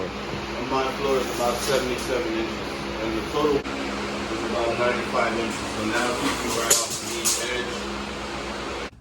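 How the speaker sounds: talking right into a fan. Real, not acted. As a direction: voice buzzing through a fan. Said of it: "Sorry for the fan, guys".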